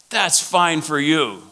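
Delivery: high, drawn-out objection; upset church lady. Retold or imitated. Small-church lady objecting to the workshop leader, upset. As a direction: imitate her slow, put-upon protest. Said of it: "She was really upset".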